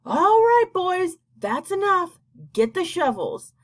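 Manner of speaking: put-on high, loud Cloud Man shout, slowing on boys; a big, high call to the work crew. A narrator's reading. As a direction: bellow the order across the cloud. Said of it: "they heard him shouting".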